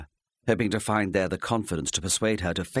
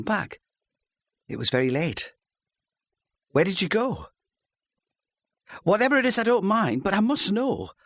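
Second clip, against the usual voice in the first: higher, pleading wife voice; anxious wife; 8 semitones higher than usual. Acted. Wife begging her husband to tell her, anxious. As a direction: press him gently but urgently for the truth.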